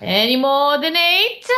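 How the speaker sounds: normal talking voice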